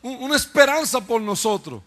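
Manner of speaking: loud, high preacher's cry, building; full-voiced pulpit fervour; fiery preaching. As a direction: preach it to the back row.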